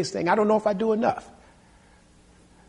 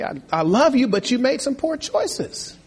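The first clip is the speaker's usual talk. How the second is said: tender, sorrowful parent; 6 semitones higher than usual. Retold or imitated. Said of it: "you go and say".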